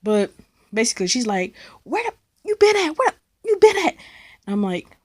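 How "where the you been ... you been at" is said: much higher, shrill mom voice; screaming mom. Retold or imitated. Mom yelling at her daughter over the phone, much higher. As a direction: shriek it like a panicked mother. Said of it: "Why are you screaming at me?".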